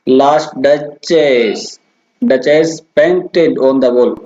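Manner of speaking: very slow, "duchess" pulled apart by syllable; alternating stress demo. Shown as a demonstration. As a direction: scan it slowly, pushing "last", the end of "duchess" and "wall". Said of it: "जैसे बोल के देख सकते हैं". (धीरे-धीरे, बारी-बारी से ज़ोर देकर)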